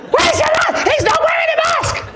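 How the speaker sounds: put-on high, screeching yell of an outraged old man; imitating a frantic shouted telling-off; shouting old man. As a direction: yell the accusation at full pitch, very high. Acted old man yelling again.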